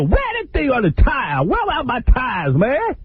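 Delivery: high, slow whiny outrage; hammed-up Bobby missing his rims; mock angry Bobby. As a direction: complain way up in your head voice. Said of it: "and he's pissed".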